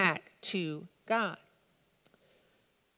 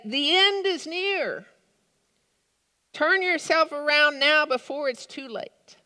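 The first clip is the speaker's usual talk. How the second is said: raised, high-pitched doomsday proclamation; proclaimed warning; 6 semitones higher, 4 dB louder than usual. Acted. Clergymen holding up a roadside sign, proclaimed warning. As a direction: call out the warning loudly.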